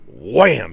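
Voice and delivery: mock impact noise, punched out; loud wham sound effect. Retelling the drill bit strike.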